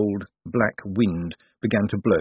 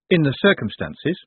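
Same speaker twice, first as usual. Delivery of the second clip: higher questioning echo, rising at the end; incredulous echo; 7 semitones higher than usual. Acted. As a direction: throw the phrase back in disbelief. Moist echoes the phrase in disbelief.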